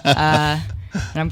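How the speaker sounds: real amusement at her own joke; a laugh carrying into the line. Real, not acted. Laughs at own rule-of-threes joke.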